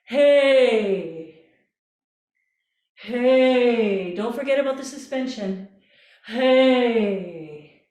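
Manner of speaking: loud open yawning hey; yawny hey. Shown as a demonstration. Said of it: "yawny sound".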